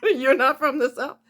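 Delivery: retold disbelief with a laugh in it; mock disbelief. Castmates question the actor after the reading, mock disbelief.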